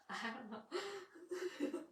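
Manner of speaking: laughing. Real, not acted. Laughing repeat of own line.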